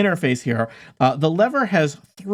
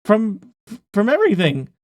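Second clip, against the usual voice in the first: earnest NPC; 5 semitones higher than usual. Acted. NPC pleads defending his plan.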